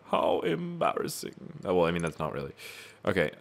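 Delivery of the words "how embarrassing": high, slow creature quote; pitched-up, drawn-out character voice; Yoda. Acted. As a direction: croon it slowly, up high. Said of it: "Yeah, it was Yoda".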